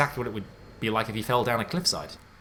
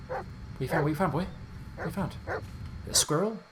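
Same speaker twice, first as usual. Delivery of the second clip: higher-pitched coaxing questions; talking to a dog; 6 semitones higher, 3 dB quieter than usual. Real, not acted. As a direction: coax the dog, voice lifting.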